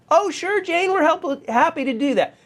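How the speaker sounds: high-pitched, eager-to-help clerk; obliging help desk. Acted. Help desk worker agreeing to reset Jane's password, obliging. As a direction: agree readily, pitched well up.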